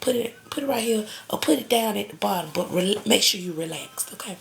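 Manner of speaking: ordinary delivery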